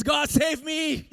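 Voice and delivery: high, loud and slow cry to God; desperate plea. Retold or imitated. Drowning man crying out to God, desperate and loud. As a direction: drag it out like you're going under. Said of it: "kind of prayer".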